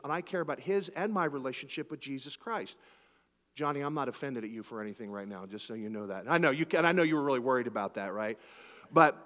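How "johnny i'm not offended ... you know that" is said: low, soft and soothing; calm him down gently, hushed; gentle reassurance. Retold or imitated. Pastor hushed telling Johnny no offense taken.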